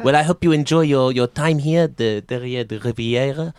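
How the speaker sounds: courteous French maître d'; hesitant, polite French host; French waiter. Acted. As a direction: welcome guests in a French accent. Plays French restaurant host.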